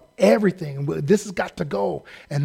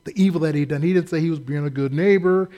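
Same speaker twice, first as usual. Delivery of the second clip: usual speaking voice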